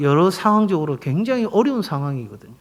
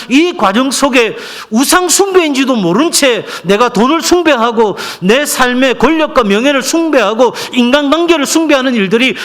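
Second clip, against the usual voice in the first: high, pressing pulpit delivery; full-force preaching; 6 semitones higher, 5 dB louder than usual. Acted. Pastor warning congregation about modern idolatry, full-force preaching. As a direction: hammer each phrase to the congregation.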